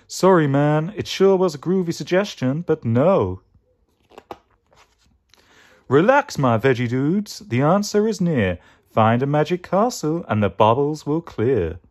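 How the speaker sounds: laid-back mystic character voice; Mystic Mango. Acted. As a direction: let them down gently like a hippie dude, then pronounce a riddle. Plays Mystic Mango, groovy guru.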